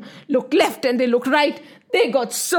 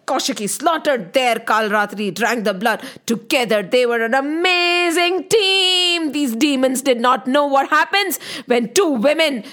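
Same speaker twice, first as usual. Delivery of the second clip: plain reading voice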